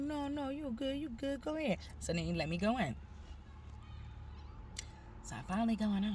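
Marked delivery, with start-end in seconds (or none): 0.0-1.8 backing-down guard. Retold or imitated. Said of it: "He like".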